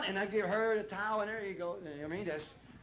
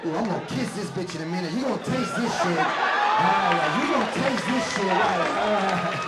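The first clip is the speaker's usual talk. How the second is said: mock seducer; 2 semitones lower, 7 dB louder than usual. Acted seductive man talking dirty.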